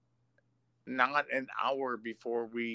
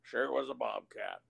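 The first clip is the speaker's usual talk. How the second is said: put-on sheriff voice. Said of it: "You're just doing the voices".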